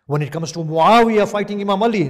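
preacher at the height of his fervour; preaching at full force. Acted. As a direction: thunder it at the congregation.

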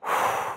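soft, voiceless exhale; breathing-exercise exhale; demonstrated breath out. Shown as a demonstration. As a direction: sigh the breath out to lead the class. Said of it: "And then breathe out".

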